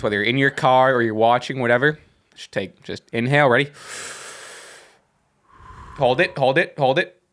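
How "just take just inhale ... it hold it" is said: guided breathing. Shown as a demonstration. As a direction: lead listeners through a breath. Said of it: "Everyone just take two seconds".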